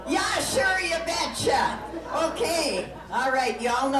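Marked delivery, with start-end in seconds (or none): 0.1-1.8 hammed-up Minnesota accent, higher; teaching the crowd Minnesotan; Minnesotan phrase. Shown as a demonstration. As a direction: sell the Minnesotan catchphrase. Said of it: "how to speak Minnesotan".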